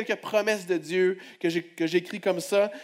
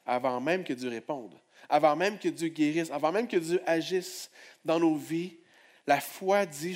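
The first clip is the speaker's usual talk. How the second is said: ordinary talk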